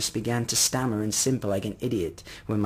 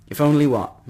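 higher, louder, falling question; sharp demand; 4 semitones higher, 7 dB louder than usual. Acted. Mother pressing the hesitant old man, sharp and impatient. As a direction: cut in and demand the rest.

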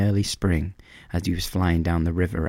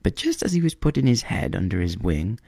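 ordinary delivery

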